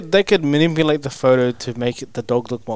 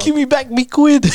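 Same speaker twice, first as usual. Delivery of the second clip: high, laughing mock British accent; British accent cracking into laughter; 13 semitones higher, 5 dB louder than usual. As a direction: squeak out a British demand for your quid. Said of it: "going British".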